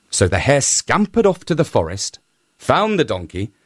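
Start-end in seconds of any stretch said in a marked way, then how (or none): none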